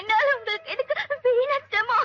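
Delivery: tearful, hitching protest; crying. Acted. Girl declining a young stranger's help, crying. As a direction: speak through sobs. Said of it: "வயசு பையன் முன்னாடி அழுதா".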